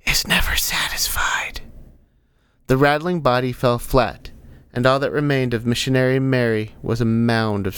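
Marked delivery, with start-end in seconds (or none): 0.1-1.6 breathy, airy whisper; whispered translation. Acted. Guide translating the creature's words for the cleric, whispering.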